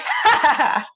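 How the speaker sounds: smug mock villain cackle; a hammy anime villain laugh. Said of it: "I was like".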